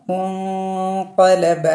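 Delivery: മുൻഖലബ drawn out very slowly with the ന hidden in nasal hum. Shown as a demonstration. Teacher showing ikhfa by reciting slowly. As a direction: stretch it, let the noon fade into the nose. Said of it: "മറച്ച് വെച്ചിട്ട് മണിക്കുക".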